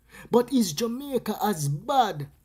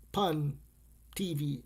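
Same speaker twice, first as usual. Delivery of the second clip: normal talking voice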